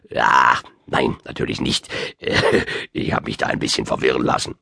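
put-on low, embarrassed old wizard; stammer, chuckle, then excuse yourself low; flustered Merlin. A narrator's reading. Old wizard admitting he got confused, flustered.

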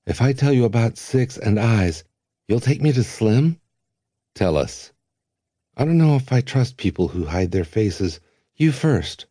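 put-on young Length. A narrator's reading. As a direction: Length holds his ground.

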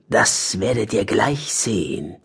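low, slow character voice; secretive badger voice. Harry the badger keeps the others guessing, low secretive voice. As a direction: hold them off, slow and low.

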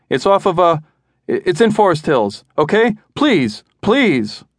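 high, breaking pleas; husband pleading on the line, high and frantic. A narrator's reading. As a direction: beg again and again, voice rising. Frantic husband calling 911.

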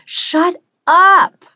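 high, loud furious yell; sharp exasperated shout; angry shout. A narrator's reading. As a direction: snap at the skull to stop talking. Narrator snaps at chattering skull.

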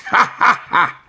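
high mocking ha ha ha; chuckling. A narrator's reading. Big cats chuckling at the kitten. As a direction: laugh down at the kitten. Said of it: "they chuckled".

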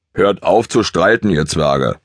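put-on a big old gelding's grumble; rumbling big horse. A narrator's reading. Ole rumbles at the quarrelling ponies in his big-horse voice. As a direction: grumble down at the little ponies like a boss. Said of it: "brummte er".

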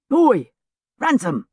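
high, loud opening hail; calling out. A narrator's reading. Fellow lieutenant hails his friend by name, sharp call. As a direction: hail a friend across the street. Fellow officer hailing William in street.

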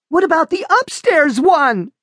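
shrill panic. A narrator's reading. Daughter asking her mother in high panic. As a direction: let panic creep in high. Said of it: "with a shrill note of panic".

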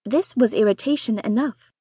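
ordinary delivery, nothing marked

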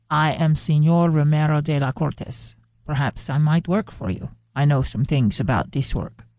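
put-on gentle, polite foreign accent with careful English; soft, courteous accent of a traveller asking for work; soft elegant accent. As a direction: speak softly in a graceful foreign accent, each word placed. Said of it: "with an accent so soft and elegant".

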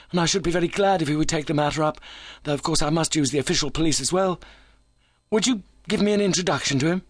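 high, fast-talking visitor; keyed-up injured visitor. A narrator's reading. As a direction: play him high-pitched and hurried, pouring it out.

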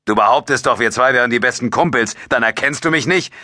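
exasperated, pitched high; losing patience. A narrator's reading. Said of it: "Allmählich verlor Jabo die Geduld".

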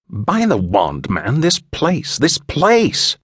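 appalled English gentleman building up; exasperated English stranger. A narrator's reading.

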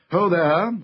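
lightly acted king calling for service. A narrator's reading. King calls out to his servants.

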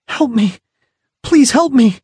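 high, desperate cry for help; in pain. Acted. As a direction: cry out, hurting and begging. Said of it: "the feeble cry".

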